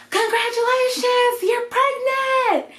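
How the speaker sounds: high-pitched, elated imitation of good news; overjoyed squeal, mimicking someone else; excited announcement. Retelling medical assistant announcing pregnancy.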